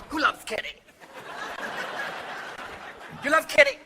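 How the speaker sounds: high, coy drag queen kitty; hammed-up camp cat voice. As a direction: coo it like a diva fishing for adoration. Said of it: "are cats drag queens?".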